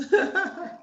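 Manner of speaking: bright high-pitched laugh. Real, not acted. Laughs at own morning voice.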